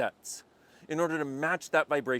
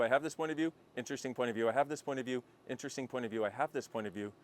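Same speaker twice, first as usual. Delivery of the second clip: repeating mantra softly; 3 semitones lower, 4 dB quieter, faster than usual. Acted. Repeats mantra, fading away.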